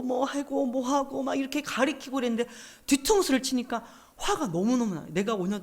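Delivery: indignant retold outburst; retold indignation. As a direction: protest the betrayal, leaning hard on the years given. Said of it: "화가 나는 거예요".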